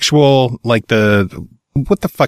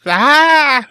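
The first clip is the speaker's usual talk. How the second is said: high goat scream; yelling goat; 14 semitones higher than usual. Pretend. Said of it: "those yelling goats".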